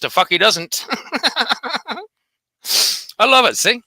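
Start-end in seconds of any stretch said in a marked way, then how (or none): 0.9-2.0 a short soft contemptuous chuckle; scoffing laugh. Real, not acted. Vlogger scoffs at the rival's claim with a soft derisive laugh. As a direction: laugh it off quietly.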